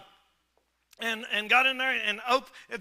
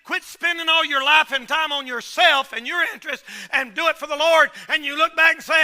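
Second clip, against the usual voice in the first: ordinary talk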